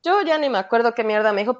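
ordinary talk

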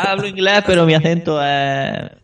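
voz más aguda, citando una queja ajena; imitando a la gente. Retold or imitated. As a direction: imita en agudo al que se queja. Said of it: "Porque mucha gente dirá". (imitando una queja, en tono agudo)

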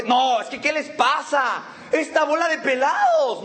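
high, drawn-out mock outrage; hammed-up offended complaint, pitched high; acted indignation. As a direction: protest indignantly, stretching the key words. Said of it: "me siento ahora sí indignado".

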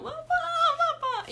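very high, drawn-out mock wail; crying scream. Woman imitating herself as a child calling her father, crying scream. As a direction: wail for dad like a terrified little kid, hammed up. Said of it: "y ya gritando".

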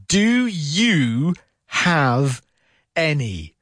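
"Do you have any?" drawn out word by word; very slow. Shown as a demonstration. Teacher slows the phrase down for learners, very slow. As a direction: stretch "Do you have any?" slowly, each word separate. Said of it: "if I slow it down".

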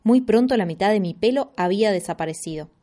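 ordinary delivery, nothing marked

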